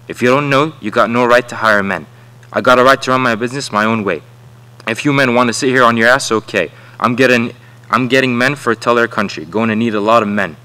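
forceful and loud throughout, a contractor laying down the law; bully the men with a hard, loud voice; a contractor telling the workers off, loud and defiant; loud angry defiance. Acted. Contractor arguing back at Floyd.

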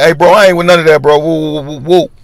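flustered woo woo; nervous refusal. Retold or imitated. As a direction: back away from it, rattled. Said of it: "I'm like".

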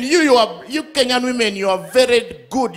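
ordinary delivery

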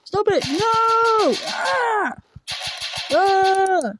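high, loud frantic screaming; panicked screams. Acted. Attacked character screams for it to stop, high panicked shrieks. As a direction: shriek as if being grabbed.

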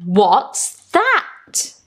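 ordinary delivery

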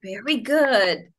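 ordinary delivery

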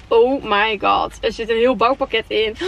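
ordinary talk, nothing marked

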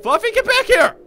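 high, loud scolding call. Acted. David calling Fluffy back, high loud shout. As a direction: shout after a runaway child. Calls runaway child back.

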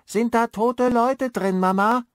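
put-on a small girl asking her mother, eerie; shrill little girl. A narrator's reading. Ghostly girl asks her mother about dead people, shrill. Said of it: "ebenso schrill".

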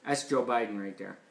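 ordinary delivery

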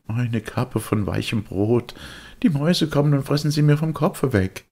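put-on high, know-it-all kid; a cocky boy brushing him off; smug boyish voice; the boy's voice. Boy scoffs.

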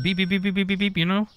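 high, rapid-fire beeping; string of high mimicked beeps; imitated scanner beeps. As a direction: beep like a busy checkout scanner. Imitates fast checkout scanner beeps.

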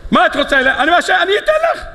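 very high, hurried acted pleading; frantic offer. Elkanah offers his wife anything she wants, acted frantic eagerness. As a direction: trip over yourself offering, pitch soaring.

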